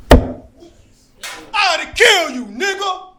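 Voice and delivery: high, loud shouted threat; full-volume acted rage; enraged threat. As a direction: yell it as an attacker mid-fight. Acting out the abusive man.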